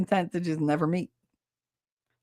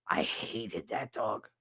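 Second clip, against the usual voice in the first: lower, softer, slow, lingering on that; deep disgust; 8 semitones lower, 11 dB quieter, slower than usual. Real, not acted. Repeating to viewers how much she hated the dog, low and slow. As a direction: sneer it out slowly.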